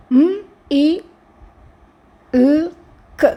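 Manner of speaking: "మ్, ఈ, ల్, క్" as four separate sounds; sounded out. Shown as a demonstration. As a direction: take "మ్, ఈ, ల్, క్" apart sound by sound with long pauses. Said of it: "వీక్ సౌండ్స్కి ఒక vowel sound".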